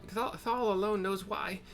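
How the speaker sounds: put-on high old woman's voice, ominous; old hag storyteller. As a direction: tell the horror like a bitter crone.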